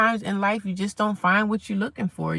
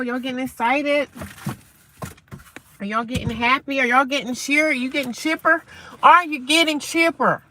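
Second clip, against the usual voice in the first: questions building higher and louder; rousing excitement; 5 semitones higher, 4 dB louder than usual. Real, not acted. Streamer rousing viewers at sunrise, building excitement. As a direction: work the crowd up, question by question.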